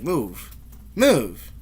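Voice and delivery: curt one-word mock command; imitated brusque demand; imitated rude order. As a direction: order it rudely, as if retelling her. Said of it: "It was very rude".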